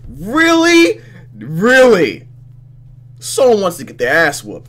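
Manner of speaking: high, very loud outraged outburst; loud indignation. Real, not acted. Player outraged that someone tripped the heroine, indignant. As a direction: explode in high-pitched indignation.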